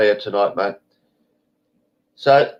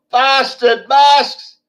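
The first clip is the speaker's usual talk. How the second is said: much higher, slow, drawn-out mock yell; retold yell; 13 semitones higher, 6 dB louder, much slower than usual. Woman crossing the front gate yells at him, mock yell. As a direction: stretch the yell out high and loud. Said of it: "she yelled out something like".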